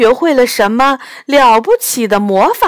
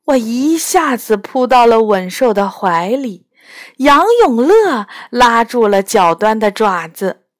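plain reading voice

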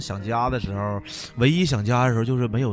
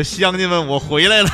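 triumphant homecoming; 6 semitones higher, 8 dB louder than usual. Pretend. Mock homecoming to villagers.

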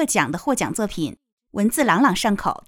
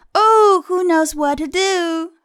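high, drawn-out storybook plea; helpless cry; 9 semitones higher, 5 dB louder, slower than usual. Acted. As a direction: wail it slowly like a helpless page. Page cries out for help.

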